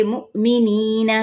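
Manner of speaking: مؤمنین slow, with a short catch of the hamza on مؤ; jolt on mu. Shown as a demonstration. Teacher showing students the hamza jolt, slow recited. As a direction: give مؤمنین a little jerk on مؤ, drawn out slowly. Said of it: "یہاں پہ جھٹکا دیں گے".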